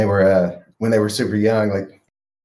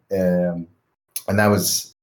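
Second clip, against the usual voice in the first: ordinary talk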